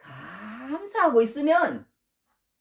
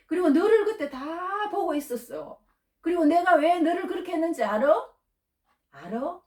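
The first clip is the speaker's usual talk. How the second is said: God's searching question; 7 semitones higher than usual. Retold or imitated. God asks the believer whether they understand why, high and earnest. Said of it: "하나님이 요즘 그러시더라고".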